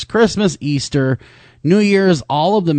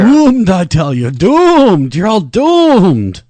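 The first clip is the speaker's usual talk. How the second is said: high, loud, slow doom-prophet wail; slow ominous raspy drawl; 8 semitones higher, 6 dB louder, much slower than usual. Pretend. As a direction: wail it like a doomsayer, slowly. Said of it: "that wasn't really very friendly at all".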